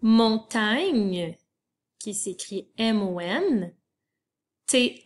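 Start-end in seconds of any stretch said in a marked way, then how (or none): none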